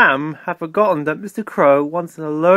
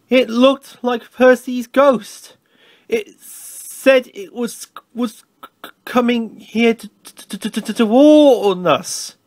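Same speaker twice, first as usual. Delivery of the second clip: slow, higher, frightened stammering; shaky voice; 6 semitones higher, slower than usual. A narrator's reading. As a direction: stutter haltingly as if scared by a ghost. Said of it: "said Toby in a shaky voice".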